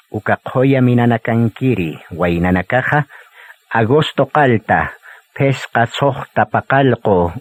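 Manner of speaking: ordinary delivery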